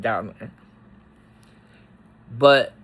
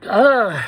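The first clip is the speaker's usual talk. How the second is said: loud, high, overwhelmed ugh; letting the feeling burst out; a sudden sob-like groan of emotion; emotional groan; 7 semitones higher, 7 dB louder than usual. Real, not acted. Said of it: "getting emotional about this".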